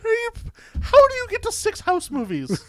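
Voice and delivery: high, stunned disbelief; can't believe it, voice way up; incredulous. Real, not acted. Disbelief at six House movies.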